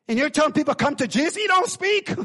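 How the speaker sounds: high, loud preacher's cry; full-force preaching. Real, not acted. Preacher rousing the congregation, loud impassioned preaching. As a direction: preach it with rising fire.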